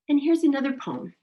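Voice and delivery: normal talking voice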